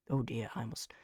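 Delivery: breathless whisper of dismay; almost no voice left, just air. Real, not acted. Dizzy and losing his accent, he whispers to himself.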